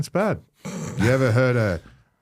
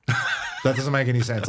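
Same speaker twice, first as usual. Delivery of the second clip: normal talking voice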